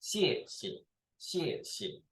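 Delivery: teacher's model "xie xie", second syllable dropped; falling, then neutral tone. Shown as a demonstration. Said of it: "neutral tone will be go down".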